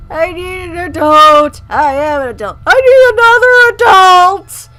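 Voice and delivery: slow, loud, rising panic; hammed-up cry for help, building; panicked shout. Panicked meme quote after creepy dad.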